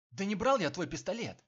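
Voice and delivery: high, loud denial; shouting. A narrator's reading. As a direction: yell the denial out. Pinned man yells denial.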